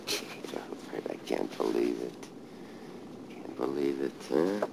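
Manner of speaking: soft, repeating disbelief dying to a whisper; hushed broken disbelief; stunned grief. Real, not acted. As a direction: murmur it over and over. Disbelief at father's grave.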